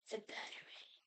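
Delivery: whispered under her breath. Real, not acted. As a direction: whisper it to yourself. Muttering to herself while checking remote.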